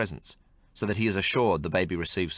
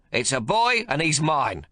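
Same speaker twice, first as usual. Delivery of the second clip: higher, louder, slow proud claim; possessive old man; 8 semitones higher, 4 dB louder, slower than usual. Acted. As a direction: claim the boy as his own. Old man voiced in story.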